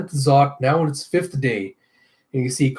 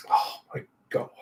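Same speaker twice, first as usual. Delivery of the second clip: breathy whisper to himself; hushed real reaction; whispered aside; 8 dB quieter than usual. Real, not acted. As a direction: whisper it, half in disbelief. Stunned by the story twist.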